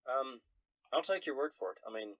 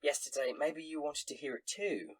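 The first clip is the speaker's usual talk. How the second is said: higher, chatty barmaid; barmaid gossip voice; 4 semitones higher, 3 dB louder than usual. Acted. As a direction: spill a rumor like a barmaid. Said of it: "she says".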